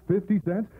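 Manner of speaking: normal talking voice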